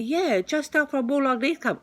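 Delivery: loud, high-pitched mock Chinese accent; attempted Chinese accent. Car seller confirming where he lives, attempted Chinese accent.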